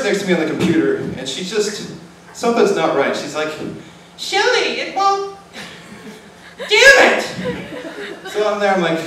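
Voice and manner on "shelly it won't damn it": very high mock nurse voice; frustrated nurse. Nurse fighting with her computer, high mock frustration.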